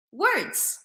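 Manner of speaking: ordinary talk, nothing marked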